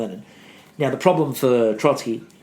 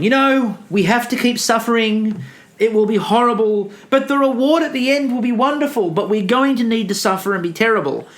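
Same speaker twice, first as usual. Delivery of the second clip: higher, louder, earnest pleading schoolgirl voice; Lisa Simpson impression; 9 semitones higher, 4 dB louder than usual. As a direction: plead like an idealistic know-it-all kid. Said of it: "it's almost like Trotsky is Lisa, standing up and going".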